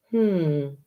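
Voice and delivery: ordinary delivery, nothing marked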